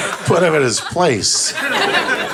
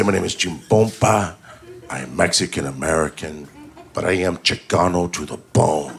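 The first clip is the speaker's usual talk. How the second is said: low, measured, solemn declaration; militant Chicano uncle; 8 semitones lower, 4 dB quieter than usual. Acted. Uncle teaching his nephew a proud introduction, low and solemn. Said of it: "No, you gotta say".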